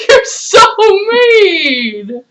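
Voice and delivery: high, laughing "you're so mean"; laughing protest. Real, not acted. Laughing at teasing.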